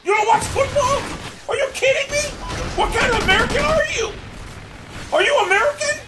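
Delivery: very high-pitched screamed disbelief; outraged shriek. Real, not acted. Outraged nobody watches football.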